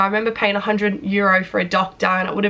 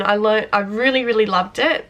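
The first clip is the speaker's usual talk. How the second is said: ordinary talk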